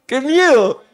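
high, loud exclamation of mock dread; playful alarm. Real, not acted. Preacher to congregation who forgot the first phrase, playful alarm. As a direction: cry out in exaggerated alarm. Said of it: "Qué miedo me han dado".

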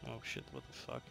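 ordinary talk, nothing marked

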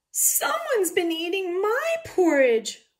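high, loud, slow wail; wailing Mama Bear. A narrator's reading. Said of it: "wailed Mama Bear".

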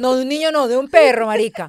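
exasperated loud correction; loud laughing correction. Real, not acted. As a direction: insist loudly it was a dog.

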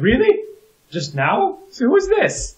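high-pitched mock disbelief; hammed-up, very high astonishment; acted incredulity. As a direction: question it high, climbing to a squeak. Acts out incredulous reaction to message.

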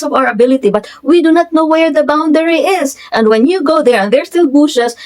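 high, insistent, indignant retelling; angry comeback. Retold or imitated. Client explaining to the surveyor about the boundary, angry comeback. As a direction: argue the point firmly, re-enacting an angry reply. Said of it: "Eh, nagagalit din ako".

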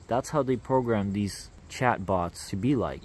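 usual speaking voice

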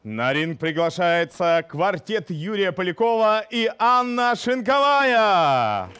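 boxing ring announcer. Acted. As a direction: announce it like a title fight. Boxing-style team introduction.

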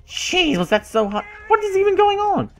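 exasperated disbelief. Real, not acted. Player losing patience with his horse, high exasperated disbelief. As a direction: let it fly up in disbelief.

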